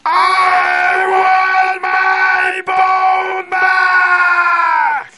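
huge high wail, every word stretched out; very angry, loud. A narrator's reading. Angry voice demands its bone from the woman, loud and furious. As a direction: shriek it slowly at house-shaking volume. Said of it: "very angry, and it was so loud that the whole house shook".

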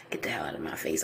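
whispered brush-off; whispered scoff. Real, not acted. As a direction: hiss it away as a dismissive aside. Scoffing aside at the expert.

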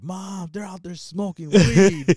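high, loud, drawn-out mock tattle; tattling kid imitation. Brother snitches to mom about weed, mocking tattletale call. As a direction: snitch to mom like a little kid, slowly and loudly.

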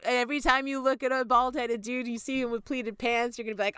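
ordinary talk, nothing marked

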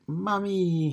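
put-on very high, sweet toddler's Mommy!; a baby girl calling Mommy! in a tiny, high voice. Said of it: "in her sweet eighteen-month-old voice".

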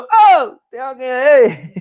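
loud high call, drawn out slowly; big shout. Retold or imitated. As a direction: holler it across the bathroom. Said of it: "Aí eu dei um gritão".

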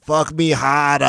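Boston accent, hammed-up bedroom line. Host mocks Boston woman in bed, Boston accent. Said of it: "Boston accent".

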